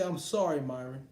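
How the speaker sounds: usual speaking voice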